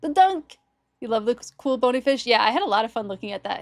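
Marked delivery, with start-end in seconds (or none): 0.0-0.6 high excited call; excited exclamation. Real, not acted. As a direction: blurt it out thrilled. Excited at seeing fish.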